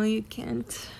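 ordinary talk, nothing marked

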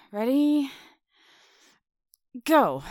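ordinary talk

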